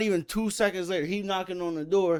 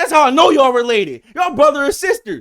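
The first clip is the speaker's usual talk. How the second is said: high, loud enraged yelling; hollering at the neighbors across the fence; furious shout; 9 semitones higher, 13 dB louder than usual. Retold or imitated. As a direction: scream it like you're ready to fight. Neighbor insulting the family.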